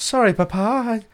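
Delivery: put-on high, apologetic posh child; mock posh accent. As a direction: play the chastened posh boy. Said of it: "lose that fucking accent".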